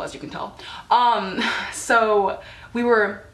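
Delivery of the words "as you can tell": sarcastic aside. Real, not acted. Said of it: "me and my friends were great people".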